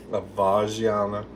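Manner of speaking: "Avargiana" slowly, with a soft French zh; slow, Frenchified "Avargiana"; French-style pronunciation. Shown as a demonstration. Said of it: "Is it kind of French".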